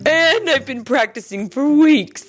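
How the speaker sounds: loud, high-pitched coyote boast; puffed-up show-off. A narrator's reading. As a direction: crow about yourself. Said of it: "Coyote boasted".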